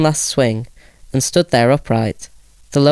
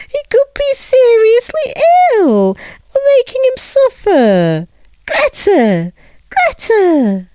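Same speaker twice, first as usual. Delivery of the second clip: very high, louder, frantic mother calling for her daughter; tearful mother crying out; 4 dB louder, slower than usual. A narrator's reading. Mother calls for Greta in a high, frantic voice. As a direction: wail it out in panic. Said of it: "she then cried".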